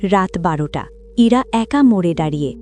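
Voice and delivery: usual speaking voice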